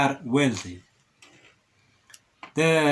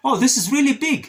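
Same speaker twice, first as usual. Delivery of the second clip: high-pitched exclamation of wonder; amazed exclamation; 9 semitones higher than usual. Acted. Teacher acting out seeing something really big, high amazed exclamation. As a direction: exclaim it as if struck by the size.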